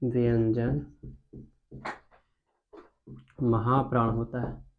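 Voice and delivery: slow, low, soft dictation. Real, not acted. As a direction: stretch each word out as you write it. Dictating slowly while writing it out. (धीमे, दबी आवाज़ में लिखवाते हुए)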